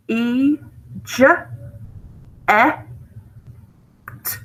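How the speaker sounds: "E, J, E, T" as separate sounds: ee, juh, eh, t; sounded out. Shown as a demonstration. Teacher spelling eject by its sounds, "E, J, E, T" sounded out singly. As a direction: sound out "E, J, E, T" with long pauses between. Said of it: "So it's gonna be just E".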